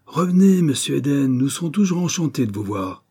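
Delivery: high, loud mocking call; jeering call. A narrator's reading. Hornet staff calling down from the landing, jeering. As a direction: call down loudly, mock-cordial. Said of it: "lui crièrent-ils du palier en riant".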